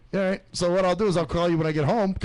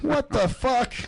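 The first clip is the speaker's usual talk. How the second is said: shocked; 9 semitones higher, slower than usual. Retold or imitated. Retold shock at matchmaker result.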